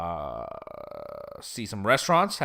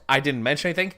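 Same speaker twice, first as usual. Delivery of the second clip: ordinary delivery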